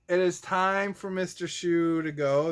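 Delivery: ordinary talk, nothing marked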